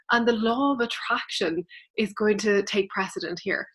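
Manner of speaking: normal talking voice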